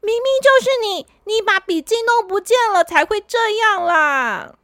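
girl annoyed at her friend, mock angry; a bit angry. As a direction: snap back that it's his fault. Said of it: "有点生气地说".

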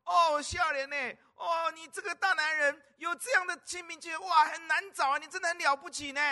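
put-on high, loud gushing old granny; admiring grandma. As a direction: praise him like a doting grandma. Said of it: "这个阿嬷就说".

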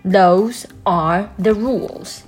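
high, slow, strict pronouncement; stern voice. A narrator's reading. Librarian closes her warning to the lion, stern. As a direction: lay it down slowly and firmly. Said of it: "she said in a stern voice".

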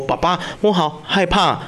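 ordinary talk

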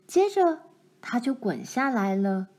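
plain reading voice

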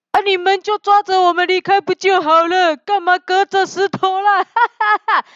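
high-voiced crow, breaking into laughter; crow leader. A narrator's reading. Crow leader tells little people to grab the crows, high and laughing. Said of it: "乌鸦首领说".

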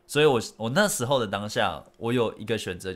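ordinary talk, nothing marked

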